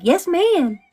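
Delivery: small obedient squeak; high, meek yes ma'am; meek high reply. Retold or imitated.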